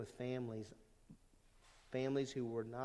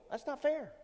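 much higher, whiny mock protest; mock complaining whine; 12 semitones higher than usual. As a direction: whine it like a sulking child. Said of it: "a lot of people that go".

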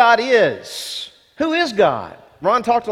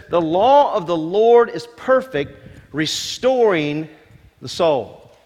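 scripture reading; slower than usual. Acted. Rereading the verse with weight.

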